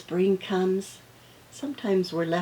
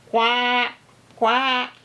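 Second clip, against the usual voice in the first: loud, raised quacks; duck quack; 5 semitones higher, 5 dB louder than usual. Acted. Teacher showing many bird sounds, loud duck quacks. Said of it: "So many bird sounds".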